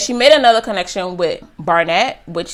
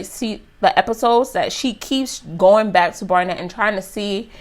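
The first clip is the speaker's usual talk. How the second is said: ordinary talk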